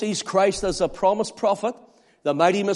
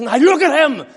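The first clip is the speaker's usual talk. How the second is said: much higher and louder, almost laughing; excited proclaiming; 9 semitones higher, 11 dB louder than usual. Acted. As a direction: cry it out in joyful wonder. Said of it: "He says".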